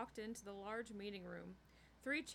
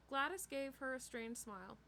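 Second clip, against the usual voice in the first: usual speaking voice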